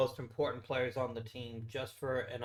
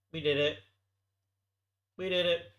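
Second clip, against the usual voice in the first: cheering we did it; small victory cheer; celebrating; 6 semitones higher, 6 dB louder than usual. Real, not acted. Celebrates finding the setting.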